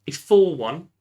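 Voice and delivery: loud, thrilled announcement; excited. Real, not acted. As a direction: burst out with joy at the score. Delighted at the scoreline.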